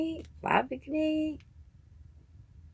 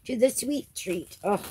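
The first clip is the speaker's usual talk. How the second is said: usual speaking voice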